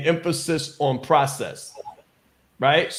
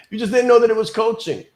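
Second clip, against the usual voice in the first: higher, louder and fast, hitting know; excited emphatic repeat; 7 semitones higher, 6 dB louder, faster than usual. Shown as a demonstration. Coach repeating her point to webinar listeners, excited and loud. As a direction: burst out with it after a laugh, fast and loud.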